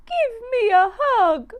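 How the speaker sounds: high, loud, wailing plea; wailing little mouse. Acted. Hurt mouse Missy wailing to her mum for a hug. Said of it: "wailed Missy".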